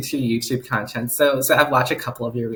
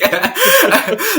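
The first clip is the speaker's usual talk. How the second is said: high, louder burst of giggling; laugh; 7 dB louder than usual. Real, not acted. Host laughing mid-sentence about personal life, higher, louder giggle. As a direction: let a real giggle break out.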